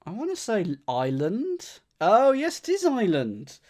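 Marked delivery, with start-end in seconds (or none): 2.0-3.6 delighted. Real, not acted. Solver cracks the clue.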